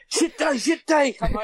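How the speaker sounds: retelling a bad day, laughing; laughing mock complaint. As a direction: moan it through laughter. Retelling own weary thought.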